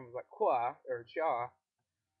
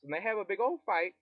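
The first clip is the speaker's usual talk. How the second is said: usual speaking voice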